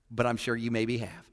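normal talking voice

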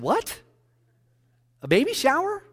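disbelieving yelp of a question; shocked disbelief. Retold or imitated. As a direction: pitch jumps way up on baby shower, incredulous. Pastor's shocked reply to wife.

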